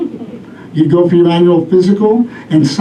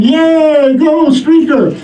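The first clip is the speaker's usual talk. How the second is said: cheering; 10 semitones higher, much slower than usual. Retold or imitated. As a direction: cheer the streaker on. Voicing the cheering families.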